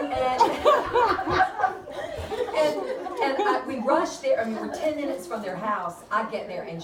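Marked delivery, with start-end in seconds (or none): 0.5-2.5 big high burst of laughter; real high laugh; hearty laugh. Real, not acted. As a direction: laugh out loud at the memory. Big laugh mid story.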